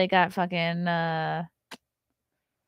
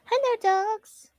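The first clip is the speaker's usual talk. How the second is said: high cooing hello to the pets; talking to dogs; slower than usual. Real, not acted. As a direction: coo at the dogs like babies. Greeting the dogs coming in.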